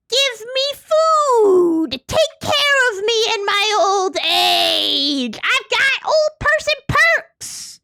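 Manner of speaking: put-on high, slow, whiny old-person voice; mock old person. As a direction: beg like a creaky elder. Player mocks old person.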